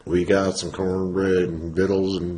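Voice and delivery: slow country drawl; hammed-up hillbilly country drawl; country accent. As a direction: draw it out in a put-on country accent. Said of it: "you going country on me".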